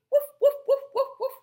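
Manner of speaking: high, loud playful woofs; puppy barking. Shown as a demonstration. Teacher showing a child how a puppy sounds, barking. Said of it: "what sound does a puppy make".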